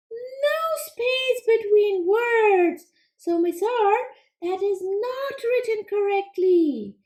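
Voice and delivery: high, quick little frog puppet, eager to answer; squeaky-high puppet frog pleased with itself; Froggy puppet voice. Acted. Frog puppet answering the teacher, high and eager.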